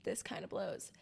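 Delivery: low, whispered deadpan; deadpan groan. Retold or imitated. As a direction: groan it out, unimpressed. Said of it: "I woke up and I was like".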